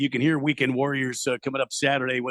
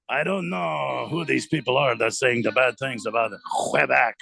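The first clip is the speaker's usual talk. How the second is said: higher-pitched, put-on Québécois French accent; 8 semitones higher than usual. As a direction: play a French-Canadian, pausing between sentences. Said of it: "You gotta do the accent".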